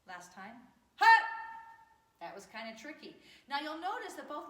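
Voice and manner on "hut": short explosive martial arts shout; kiai shout. Shown as a demonstration. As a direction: belt it from the gut. Karate drill, shouted kiai.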